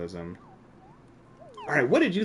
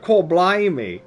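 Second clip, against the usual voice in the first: slow, loud, higher mock Cockney British; a hammed-up movie Cockney exclamation; bad British accent; 9 semitones higher, 11 dB louder than usual. Streamer testing a British phrase, mock Cockney British. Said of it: "that's not good".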